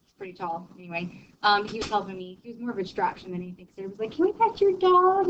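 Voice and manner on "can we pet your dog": put-on high, slow eager child voice; kids asking. Neighborhood kid asking to pet the dog, high and eager. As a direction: ask sweetly like a little kid.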